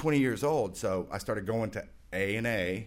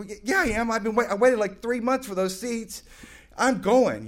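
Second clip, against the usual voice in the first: higher, louder, stumbling start; stubborn insistence; 10 semitones higher, 4 dB louder than usual. Retold or imitated. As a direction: refuse to back down. Insisting on going to concert.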